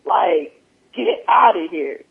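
normal talking voice

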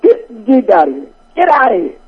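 put-on Italian accent. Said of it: "I actually got a good one".

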